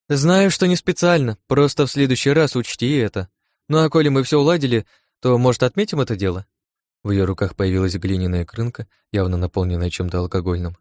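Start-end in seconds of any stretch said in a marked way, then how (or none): 0.1-6.5 lively, conciliatory character voice; brushing off apology. A narrator's reading. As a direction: wave the apology away, pitched up. Brisk friend brushes off the apology.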